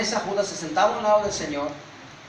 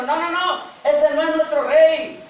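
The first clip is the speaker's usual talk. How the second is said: high, loud, drawn-out rejection; slow, emphatic roar of refusal; enraged mob shouting; 9 semitones higher, 6 dB louder, slower than usual. Acted. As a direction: shout it like an inflamed crowd. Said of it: "la turba enardecida gritaba".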